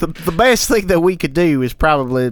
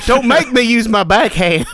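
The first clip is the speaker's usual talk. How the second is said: mock threat; 7 semitones higher, 4 dB louder than usual. Joking threat in character.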